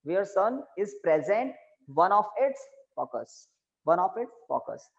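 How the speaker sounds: slow dictation pace; dictating. Shown as a demonstration. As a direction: say it slowly for writing. Dictating note slowly. (धीरे-धीरे लिखवाते हुए)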